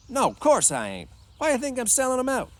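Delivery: high, loud protest; indignant denial. A narrator's reading. New hand denying he sells out his friend, indignant. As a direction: push back hard at the accusation. George protests boss's accusation.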